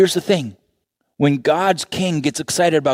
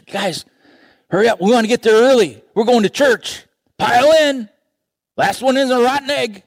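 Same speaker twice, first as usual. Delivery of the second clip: higher, louder, fast rallying call; eager dad calling; 10 semitones higher, 6 dB louder than usual. Acted. A dad calling his kids to church, loud and eager. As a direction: hustle the kids out the door.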